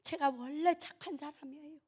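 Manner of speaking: gentle high protest; meek self-defence. Acted. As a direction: say it small and softly, pleading innocence. Meek comic self-defence, first take.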